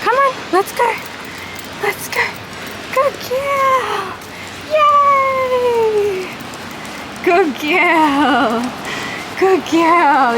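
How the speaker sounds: high squeaky coaxing dog praise; squeaky praising a dog. Real, not acted. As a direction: call the puppy on in a high voice. Praising puppy.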